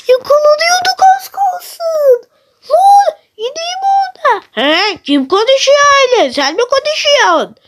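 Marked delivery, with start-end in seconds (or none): 0.1-4.4 high, breathless relief; high-pitched giddy relief; nervous excitement. Real, not acted. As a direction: squeak it out after a close call. Near miss sneaking.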